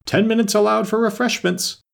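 very high, sustained announcement; high-pitched proclamation; calling out. A narrator's reading. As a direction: call it out to the whole crowd. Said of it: "while the king called out".

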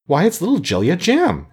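high, bright-eyed cry of recognition; exclamation. A narrator's reading. Scarecrow greets the Green Maiden with a high glad exclamation. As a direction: call out her name in glad surprise. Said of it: "exclaimed the Scarecrow".

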